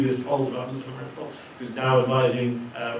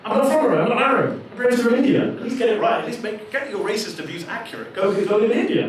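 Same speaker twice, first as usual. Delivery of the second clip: raised, higher indignant retort; heated retort; 9 semitones higher, 5 dB louder than usual. Real, not acted. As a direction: fire back, louder and up. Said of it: "heated retort to online abuse".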